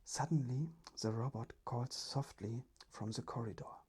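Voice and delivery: ordinary delivery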